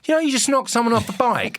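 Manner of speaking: high-pitched retold telling-off; mock outraged call across the road; indignant challenge. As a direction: confront the driver, voice pitched right up. Retold confrontation with car driver.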